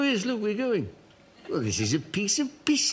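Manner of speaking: ordinary talk, nothing marked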